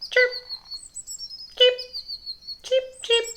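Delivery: very high tiny chirps; baby chick cheeps. Acted. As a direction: peep like newborn chicks. Chicks chirp on his head.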